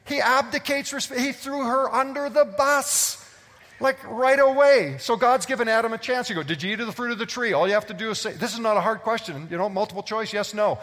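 fervent pulpit preaching, pushing hard; preaching at full force. Acted. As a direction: fire it at the congregation.

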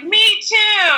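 high shouted me too; shouted agreement. Retold or imitated. Lance backing up Justin, high shout. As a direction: call it out, joining in.

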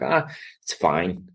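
slow, soft resigned sigh-line; mock resigned shrug; resigned it's fine. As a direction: drag it out like you've given up. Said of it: "And I'm like".

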